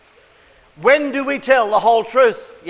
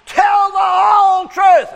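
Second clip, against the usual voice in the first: put-on shouted high and slow for kids to copy; group yell; 11 semitones higher, 4 dB louder, slower than usual. Shown as a demonstration. Leading children in a shouted chant. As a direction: belt it out to lead the children. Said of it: "We're going to yell out".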